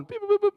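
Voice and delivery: high-pitched dialing beeps; imitated phone beeps. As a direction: mimic rapid keypad beeps. Imitates dialing nine one one.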